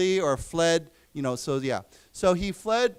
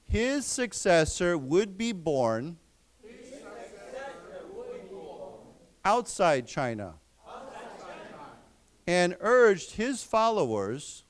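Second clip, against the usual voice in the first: slow, deliberate phrases with long gaps; read-aloud for repeating; 4 semitones higher than usual. Shown as a demonstration. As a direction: model each chunk clearly for the class to echo. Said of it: "so let's read this".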